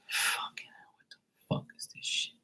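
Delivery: unvoiced whisper of imagined disgust; horrified whisper. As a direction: hiss it under your breath, appalled. Imagining seeing the name.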